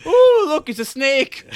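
high, loud granny exclamation; mock delighted Nan. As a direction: show off a toy in a high granny voice. Voicing Nan playing with pipe cleaners.

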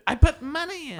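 very high, loud mock heckler's cry; imitated drunken screaming. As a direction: screech the demand like a tipsy customer, amused. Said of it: "She kept screaming it at us".

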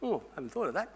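hushed, acted dawning thought; mock realization. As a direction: imitate someone softly taken aback. Acted person caught without an answer.

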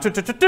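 high sung-out trumpet fanfare; trumpet imitation. Preacher mimics the trumpet calling heaven to assembly, high fanfare toots. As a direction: toot like a herald's trumpet. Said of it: "And a trumpet sound".

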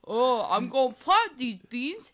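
lightly voiced dim giant. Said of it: "giants talk like this".

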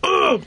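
high-pitched "ugh" of disgust; exasperated groan. Real, not acted. Exasperated by his tweets.

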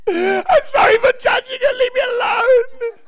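high, loud, tearful begging; desperate crying plea. Retold or imitated. Preacher pleading with Jesus in his head, high tearful cry. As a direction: cry out for mercy, overwhelmed. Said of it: "in my head, I went".

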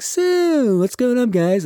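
very high, hammed-up chummy greeting; mock eager butting-in. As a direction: wheedle your way into the group, way up high. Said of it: "he almost wants to be, like, in the conversation".

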